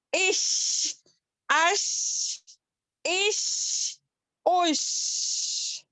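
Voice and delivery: spray the sh like perfume. Shown as a demonstration. Teacher imitating a perfume spray with sheen. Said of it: "ہماری آواز spread ہوتی ہے".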